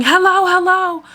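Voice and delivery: high, demanding mock yell; high-pitched retold yelling, easing off at the end; imitated screaming. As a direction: act out an impatient passenger calling out. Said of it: "she's, like, screaming".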